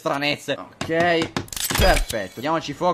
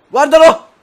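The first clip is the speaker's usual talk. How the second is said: very high, loud gloating yell; excited shouting; 10 semitones higher, 9 dB louder than usual. Real, not acted. Player seeing his bet come true, excited shouting. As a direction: shout it in triumphant disbelief. Zombie walks into the fire.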